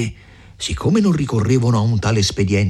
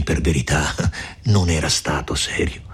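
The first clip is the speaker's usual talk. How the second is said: plain reading voice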